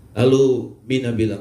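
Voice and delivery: usual speaking voice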